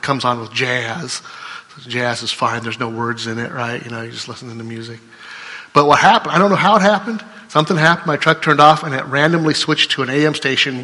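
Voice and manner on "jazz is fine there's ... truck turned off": usual speaking voice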